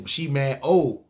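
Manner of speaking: ordinary delivery, nothing marked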